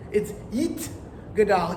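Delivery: Yit Gadal with a crisp T, not an S. Shown as a demonstration. Teacher showing how we say it, T sound. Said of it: "instead of Yis Gadal".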